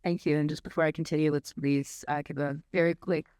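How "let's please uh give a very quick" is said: ordinary talk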